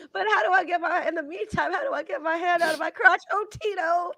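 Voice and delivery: high voice, turning to a whimper; hammed-up tearful begging; mock pleading. Stuck man begging Tito to hear, mock pleading. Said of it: "Hear my cry".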